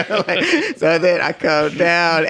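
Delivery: slow, talk through a laugh. Real, not acted. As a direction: keep giggling while you go on. Still laughing while continuing story.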